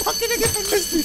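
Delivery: high-pitched laughing words; amused squeal. Real, not acted. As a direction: talk through a sudden high laugh. Laughs while watching a threat.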